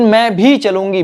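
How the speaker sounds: भी lifted and stressed. Shown as a demonstration. Teacher stresses भी for students, lifted. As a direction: press on भी. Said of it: "भी word ने जोर दे दिया".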